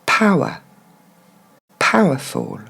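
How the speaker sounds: "Power", then "Powerful", with a brief uh for er; short discreet middle syllable. Shown as a demonstration. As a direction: stress "Pow", barely touch the er. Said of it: "il sera très court, très discret ce son".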